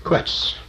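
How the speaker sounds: Quetz as KWETS, with the U sounded as w; pronunciation demo. Shown as a demonstration. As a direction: say Quetz in one syllable, kwets. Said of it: "just say Quetz".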